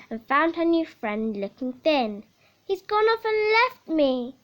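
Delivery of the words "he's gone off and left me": a lightly higher, sad cat voice; the abandoned cat, lightly voiced; lightly voiced lonely tomcat; forlorn cat. A narrator's reading. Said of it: "said Tabby McCat".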